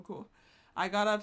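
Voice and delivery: ordinary talk, nothing marked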